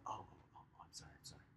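imitating kids' tiny whisper; whispered apology. Kids catching on and apologizing to teacher, whispered. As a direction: drop to a quick hushed apology. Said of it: "You see how I'm whispering to you?".

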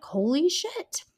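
holy shit, as the roommate hit with disbelief; stunned. Retold or imitated. Roommate seeing the bloodied girl walk in, mock stunned. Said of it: "was like".

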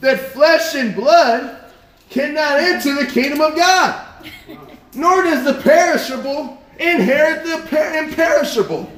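ringing pulpit delivery; preaching scripture. Acted. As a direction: preach the verse out, high and loud. Reads scripture aloud forcefully.

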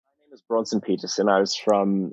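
English accent. Shown as a demonstration. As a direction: do an amateur British character. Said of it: "let's just have it with your English accent".

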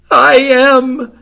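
high, loud wail of ruin; hammed-up despairing countess; theatrical gasp. A narrator's reading. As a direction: gasp it out in melodramatic anguish. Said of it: "she gasped".

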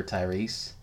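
ordinary talk, nothing marked

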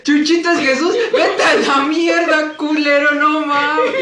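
whiny Jesus. Pretend. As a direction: sulk and curse like a spoiled child. Voicing Jesus swearing.

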